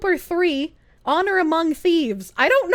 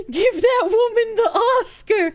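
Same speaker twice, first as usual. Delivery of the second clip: laughing through the words; can barely speak for laughing; 5 semitones higher than usual. Real, not acted. Laughing praise of the act.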